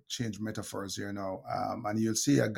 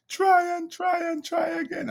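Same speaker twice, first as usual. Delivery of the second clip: put-on much higher, loud shouting child through tears; tearful child's shout; 6 dB louder than usual. Dad retells his crying daughter shouting the lesson back. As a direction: yell it back like a frustrated crying seven-year-old.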